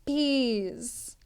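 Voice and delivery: ordinary delivery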